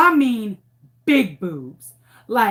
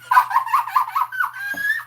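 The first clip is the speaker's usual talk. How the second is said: imitated kids' laughter. Kids cracking up.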